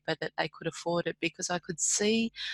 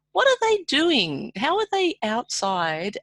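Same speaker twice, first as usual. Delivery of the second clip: high, slow incredulous question; baffled inner voice of a bank clerk; puzzled wondering; 8 semitones higher, 5 dB louder, slower than usual. Retold or imitated. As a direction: ask it in disbelief, pitch way up. Retold puzzled thought at work.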